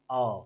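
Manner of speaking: "औ" low, soft and drawn out; low demonstration of "औ"; open औ vowel. Shown as a demonstration. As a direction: hold a rounded, open "औ". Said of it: "इसको क्या बोलेंगे".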